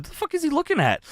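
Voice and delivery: high, fast, disbelieving; incredulous rant; baffled outburst. Retold or imitated. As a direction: rattle it off in confusion. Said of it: "I said".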